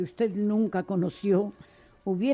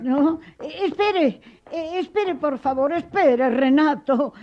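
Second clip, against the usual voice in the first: higher and louder pleading calls; calling him back; 5 semitones higher, 4 dB louder than usual. Acted. Teacher begging the leaving boy to wait, raised pleas. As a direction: stop him at the door, urgently.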